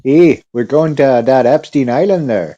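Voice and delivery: put-on a light Wisconsin suburban mom accent. Joking about Epstein Island in a Wisconsin mom accent. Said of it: "suburban mom for Wisconsin".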